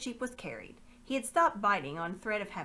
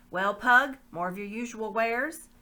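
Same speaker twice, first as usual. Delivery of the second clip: a louder, haughty lord calling out; lordly bearded man; 3 semitones higher, 9 dB louder than usual. Acted. Bearded lord greeting Pug, haughty and loud. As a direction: hail the slaver with disdain.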